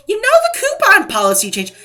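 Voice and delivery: high, loud mock parroting; mocking imitation. Parroting the excuse she gets from stores, high mocking imitation. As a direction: squeak out the excuse in mockery.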